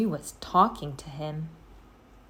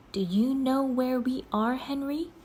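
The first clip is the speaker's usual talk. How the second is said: Annie asking softly; 7 semitones higher than usual. A narrator's reading. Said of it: "she asked softly".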